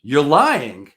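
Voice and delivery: loud, blunt accusation; accusing. Retold or imitated. Prospect loudly accusing the caller of lying. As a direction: call him a liar outright. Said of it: "And he goes".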